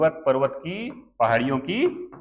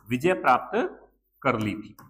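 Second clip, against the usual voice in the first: normal talking voice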